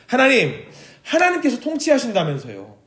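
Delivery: high, pressing complaint lifted above her own voice; protesting to God. Acted. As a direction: argue with God, demanding an answer. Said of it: "라고 하나님께 따졌던".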